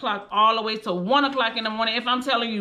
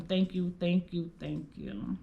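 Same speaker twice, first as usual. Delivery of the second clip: softer, fading whispered thanks; grateful prayer; 2 semitones lower, 9 dB quieter than usual. Retold or imitated. Praising God every day, soft fading thanks. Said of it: "I will praise him and I will tell him".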